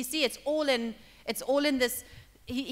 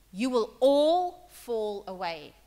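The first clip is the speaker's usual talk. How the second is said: slow solemn warning; 6 dB louder, much slower than usual. Acted. Preacher voicing Jesus' warning.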